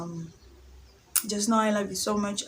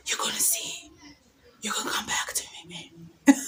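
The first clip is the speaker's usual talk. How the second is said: whispered vow; 6 semitones higher than usual. Retold or imitated. Staring at his messages, whispering a playful curse. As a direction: whisper it like a witch's promise. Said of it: "I'm like".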